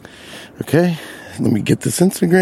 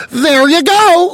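high, drawn-out self-satisfied announcement; imitating a pleased old customer; happy with himself; 13 semitones higher, 4 dB louder, slower than usual. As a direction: proudly sing it out, pitched way up. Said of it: "like, happy with himself".

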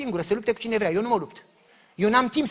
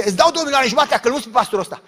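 loud eager offer; 5 semitones higher, 9 dB louder, faster than usual. Retold or imitated. She bargains with conference.

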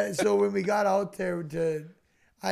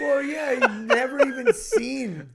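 laughing; 7 semitones higher, 6 dB louder than usual. Real, not acted. Laughing over friend's naivety.

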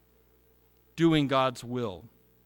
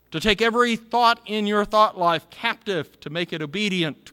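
louder, higher, driving preacher's call; fervent preaching; 4 semitones higher, 4 dB louder than usual. Acted. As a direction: press every question on the congregation. Preaching full force.

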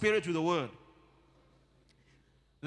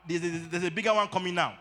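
ordinary talk

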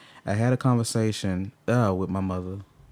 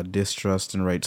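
usual speaking voice

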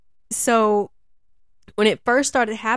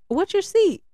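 asking seat; 11 semitones higher than usual. Retold or imitated. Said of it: "I used to be like".

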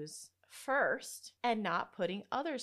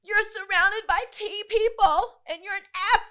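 imitating best friend; 12 semitones higher, 10 dB louder than usual. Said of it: "she's like".

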